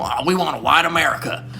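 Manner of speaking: hammed-up mock of a bigot. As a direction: sneer it out as the people you mock. Mocking racist Republicans.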